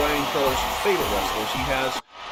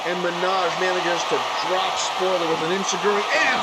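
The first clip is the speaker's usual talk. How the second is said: high, loud ringside commentary call; breathless wrestling announcer hype; excited play-by-play; 7 semitones higher, 3 dB louder than usual. Acted. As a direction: call the match like the crowd is on its feet.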